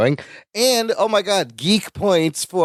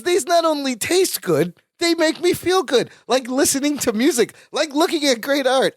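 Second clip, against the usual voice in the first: 1 Larry, raised pitch; 7 semitones higher than usual. Retold or imitated. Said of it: "He goes".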